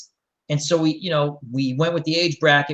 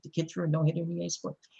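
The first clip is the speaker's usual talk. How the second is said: quiet, fast, deflated mutter; deadpan mutter; 11 dB quieter, faster than usual. Retold or imitated. Coach repeating the kid's video-game claim, muttered deadpan. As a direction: mutter it under your breath, resigned. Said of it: "I'm like".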